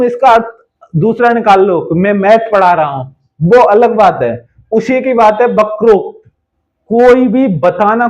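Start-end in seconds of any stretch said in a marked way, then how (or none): none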